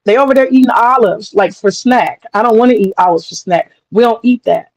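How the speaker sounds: normal talking voice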